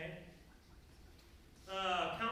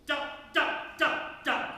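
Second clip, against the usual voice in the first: loud, high, clipped das; short punched hits; 8 semitones higher, 12 dB louder than usual. Shown as a demonstration. Conductor shows the band the combined sound, loud short hits. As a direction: punch out four separate das. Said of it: "is what it should conglomerately sound like".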